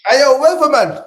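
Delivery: loud, high hype call; calling out loudly. Real, not acted. Streamer hyping up the weatherman guest with a loud, high call. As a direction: hail him across the room, loud and high.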